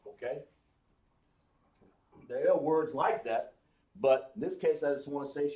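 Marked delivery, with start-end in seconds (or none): none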